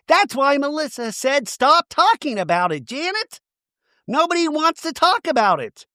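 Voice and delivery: very high, strained put-on voice; mock him with a squeaky whine; mocking Danny voice. Danny quoted in recap.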